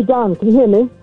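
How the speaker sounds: ordinary talk, nothing marked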